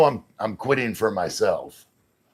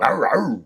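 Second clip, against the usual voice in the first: loud, raised imitation bark; playful dog bark; 6 semitones higher, 5 dB louder than usual. Streamer nicknames a viewer Lumber Dog, playful barking. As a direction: bark out a doggy greeting.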